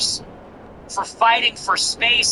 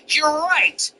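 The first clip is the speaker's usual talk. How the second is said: higher, smug mock confession; mocking Sneako; 6 semitones higher than usual. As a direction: parody him owning it with a sneer. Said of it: "He goes".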